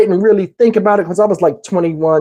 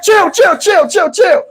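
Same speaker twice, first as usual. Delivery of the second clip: high, rapid repeated pleas; frantic pleading; 11 semitones higher, slower than usual. Retold or imitated. As she ties the shirt over his face: high panicked pleading. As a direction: beg her to stop, voice up high. Pleading while being choked.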